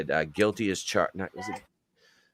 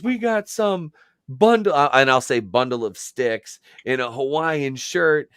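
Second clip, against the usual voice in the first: Bubba Ray promo; 7 semitones higher than usual. Retold or imitated. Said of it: "he goes".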